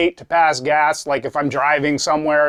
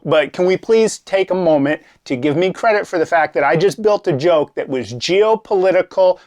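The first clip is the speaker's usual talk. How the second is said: ordinary delivery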